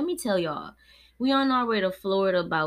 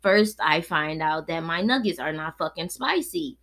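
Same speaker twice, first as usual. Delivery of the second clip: normal talking voice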